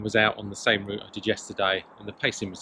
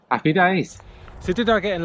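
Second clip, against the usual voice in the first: excited; 8 semitones higher, 6 dB louder than usual. Real, not acted. Cheerful sign-off.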